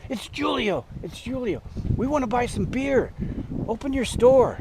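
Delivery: high, eager mock plea for beer; imitating a pushy friend at night; eager pleading. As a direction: wheedle him into opening up. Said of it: "And he goes".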